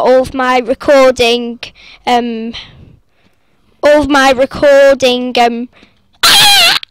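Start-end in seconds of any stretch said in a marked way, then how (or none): 6.2-6.8 very high, loud shriek; scream. Real, not acted. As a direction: scream suddenly mid-sentence. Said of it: "Sorry for screaming".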